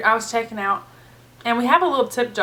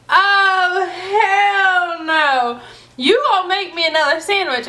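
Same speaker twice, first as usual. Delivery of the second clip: outraged customer; 8 semitones higher, 3 dB louder, slower than usual. Retold or imitated.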